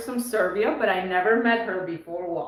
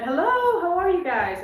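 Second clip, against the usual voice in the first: high, lilting hello from the tellers; imitated greeting; 9 semitones higher than usual. Retold greeting from bank tellers.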